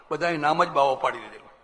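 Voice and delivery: normal talking voice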